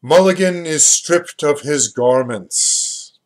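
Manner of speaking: slow, loud mock-solemn announcement; a grand, hammed-up sigh of defeat; mock resigned. A narrator's reading. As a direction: play at weary resignation. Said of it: "saying resignedly".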